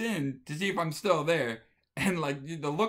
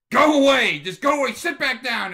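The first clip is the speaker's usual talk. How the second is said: furious yell, much higher and much louder; angry shout; 11 semitones higher, 14 dB louder than usual. Retold or imitated. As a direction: scream at him to back off. Wife yells at husband.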